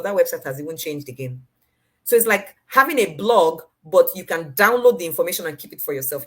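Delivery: ordinary talk, nothing marked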